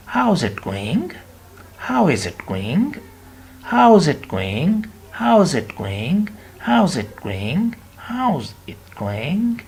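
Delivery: slow, drawn-out "How's it going?" rising; teacher stretching "How's it going?" for learners; slow model phrase. Shown as a demonstration.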